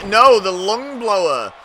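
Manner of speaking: loud, high, slowed move call; excited call. Real, not acted. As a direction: belt out the move name.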